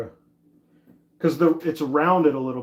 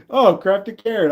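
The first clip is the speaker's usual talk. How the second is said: a bright, louder, higher hello to a chat friend; light up on her name, pitched up and louder; excited greeting; 6 semitones higher, 6 dB louder than usual. Real, not acted. Greeting chat viewer Crafty Karen on stream, excited and loud.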